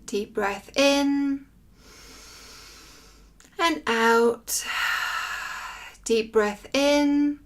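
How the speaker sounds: slow, drawn-out meditation voice with long pauses; guided meditation. Acted. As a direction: guide listeners gently through breath and body, lingering on words.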